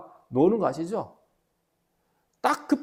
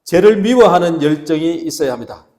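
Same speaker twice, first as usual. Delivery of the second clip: very loud, driving preacher's call; leading group repetition; 14 dB louder than usual. Shown as a demonstration. Pastor leading the congregation in repeating, full-force loud call. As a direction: belt it out so everyone repeats along. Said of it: "한번 따라합시다".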